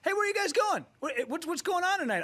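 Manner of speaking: very high-pitched imitated street greeting; mock excited hollering; eager shouted reply. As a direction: call back eagerly to friends in a car. Acting Big J's reply to yell.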